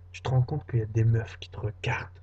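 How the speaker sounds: usual speaking voice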